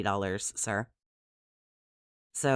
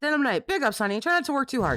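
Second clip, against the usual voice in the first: sugary streamer voice; 11 semitones higher, 3 dB louder, faster than usual. Pretend. Mock sweet streamer shout-out.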